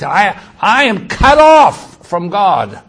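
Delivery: loud, high, slow self-curse; anathematizing oneself. Acted. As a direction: proclaim a curse on yourself. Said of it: "He anathematized".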